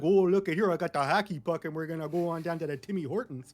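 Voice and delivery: mock a cartoonish Canadian; exaggerated Canadian caricature; over-the-top Canadian. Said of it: "He didn't come after us saying".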